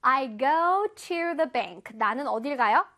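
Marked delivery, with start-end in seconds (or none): 0.0-2.0 I read books at the library, every word drawn out; slow example sentence. Shown as a demonstration. Teacher reading an example sentence slowly to kids. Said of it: "해볼게요".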